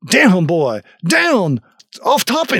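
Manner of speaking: sharp loud dog command; commanding shout. Acted. Commanding dog, fake German.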